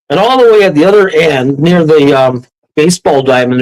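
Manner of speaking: plain reading voice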